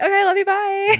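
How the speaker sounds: high, loud singsong sign-off; cheery goodbye. Retold or imitated. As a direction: chirp a quick phone farewell. Acted cheery sign-off after family argument.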